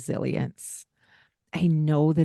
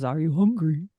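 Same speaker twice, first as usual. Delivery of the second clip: intrusive voice; 2 semitones lower than usual. Retold or imitated. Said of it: "this voice comes into their world".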